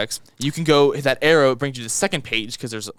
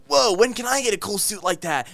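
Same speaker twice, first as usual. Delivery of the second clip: put-on excited kid voice; 7 semitones higher than usual. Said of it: "he'll be like".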